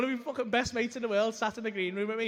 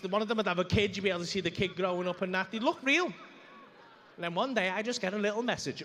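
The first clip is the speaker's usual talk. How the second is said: usual speaking voice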